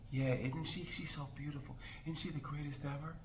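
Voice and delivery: hushed fake praise. Mimicking quiet fake agreement.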